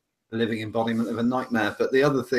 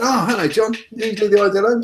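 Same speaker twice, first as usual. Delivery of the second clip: high-pitched, loud imitation of Brad; squeaky mock voice of an eager guy; imitating Brad; 10 semitones higher, 7 dB louder than usual. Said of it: "I won't do the South African accent".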